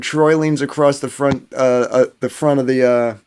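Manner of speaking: ordinary talk, nothing marked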